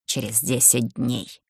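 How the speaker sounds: low, soft otherworldly voice, десять slow; rustling voice from beyond saying десять; eldritch horror. Acted. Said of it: "шелестящим потусторонним голосом".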